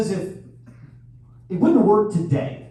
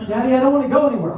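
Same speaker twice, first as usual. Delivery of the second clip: put-on fast, higher, louder child plea; lightly voiced child; 7 semitones higher, 5 dB louder, much faster than usual. Comic voicing his daughter at bedtime, fast pleading. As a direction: plead like a scared little kid.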